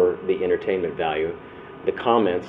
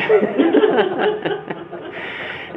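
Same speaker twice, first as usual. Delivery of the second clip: high breathless laugh; high unvoiced burst of laughter; airy laugh. Real, not acted. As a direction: let a big airy laugh out. Laugh after friend's line.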